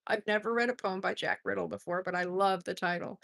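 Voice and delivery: normal talking voice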